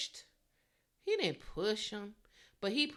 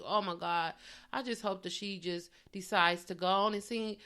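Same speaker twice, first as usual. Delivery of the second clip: ordinary talk, nothing marked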